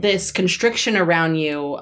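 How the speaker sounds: ordinary delivery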